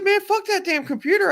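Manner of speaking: high, loud, exasperated retelling; emphatic brush-off, acted out; urgent dismissal. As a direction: cut through a friend's fretting. Urgently overruling panicking friend, retold.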